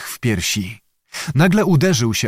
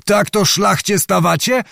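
higher indignant challenge, mildly shouted; a restrained cry of challenge; Zagłoba; 7 semitones higher than usual. A narrator's reading. Nobleman challenging fleeing foes, mild shout. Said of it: "krzyknął".

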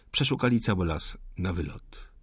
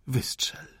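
soft unvoiced whisper; whispered dare to shoot; whispered plea; 3 semitones higher, 3 dB quieter than usual. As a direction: breathe the word, begging. Wounded man begs to be shot.

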